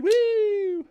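a short high whoop of delight. Real, not acted. Streamer cheering his freshly sharpened Archer, whooping. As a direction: let out a quick excited whoop.

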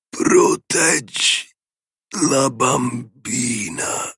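very slow, hoarse rasp fading away; a dying man's last plea, remembered. A narrator's reading. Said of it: "la voce rauca". (voce rauca e lentissima di moribondo)

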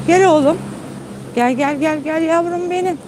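high, sing-song call to a pet; coaxing the dog. Real, not acted. Owner calling her dog over in a high coaxing call. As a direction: sweet-talk the dog to come.

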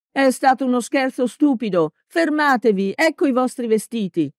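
high, desperate calling out; pleading shout. A narrator's reading.

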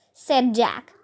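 plain reading voice

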